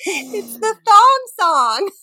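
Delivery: high, drawn-out squeal; giggly exclamation. Real, not acted. Host finally gives the guesser the title, giggly. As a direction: squeal the reveal slowly through laughter.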